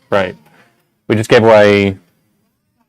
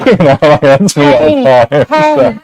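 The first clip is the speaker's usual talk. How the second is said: laughing; 4 semitones higher, 3 dB louder, slower than usual. Real, not acted. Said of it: "I have a nasally voice".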